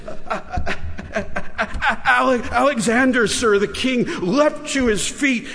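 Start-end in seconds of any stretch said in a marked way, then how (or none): 0.1-3.6 stammering, higher, breaking on the name; frightened stammer. A narrator's reading. Terrified boy stammers his name before the king, stuttering. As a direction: stutter it out in panic.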